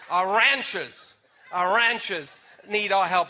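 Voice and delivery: slow, laughing American accent with a hard r in ranchers. Shown as a demonstration. Candidate laughingly drawls ranchers for a farm audience. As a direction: stretch out ranchers like a Californian rancher. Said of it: "And the other one".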